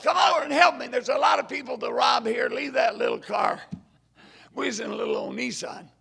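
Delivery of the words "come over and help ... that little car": loud yelled command to a buddy; mock gunman yelling across the road; yelled order. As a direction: shout it across the street at your partner. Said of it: "he yelled".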